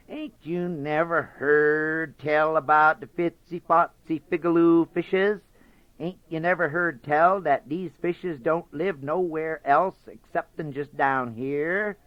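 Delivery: lightly voiced chummy rabbit; nice and chummy. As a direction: sweet-talk the fox, friendly. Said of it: "talking so nice and chummy".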